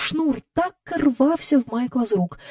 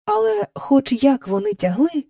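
plain reading voice

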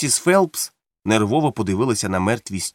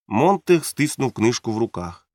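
ordinary delivery, nothing marked

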